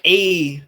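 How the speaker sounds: loud, drawn-out "A" like eh; demonstrating the "A". Shown as a demonstration. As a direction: punch "A" as a long eh. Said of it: "have I said A to you yet".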